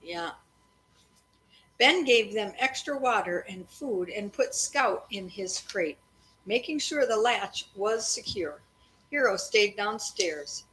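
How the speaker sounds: steady read-aloud storytelling voice; story narration. A narrator's reading. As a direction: read it evenly to the kids at bedtime. Parent reading a chapter aloud.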